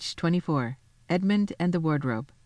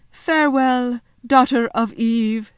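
high, loud, slow faun farewell; heartfelt farewell; 6 semitones higher, 6 dB louder, slower than usual. Acted. Faun parting from Lucy at the lamppost, heartfelt farewell. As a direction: draw out the goodbye. Faun bids the child goodbye.

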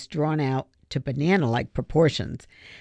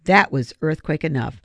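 usual speaking voice

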